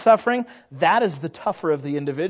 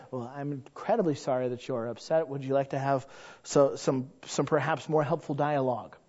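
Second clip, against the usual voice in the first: softer, even and composed; unruffled, gently polite; soft, composed apology; calm polite reply; 3 semitones lower, 8 dB quieter than usual. Retold or imitated.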